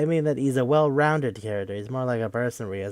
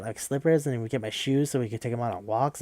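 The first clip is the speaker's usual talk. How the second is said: ordinary talk, nothing marked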